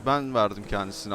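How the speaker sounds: usual speaking voice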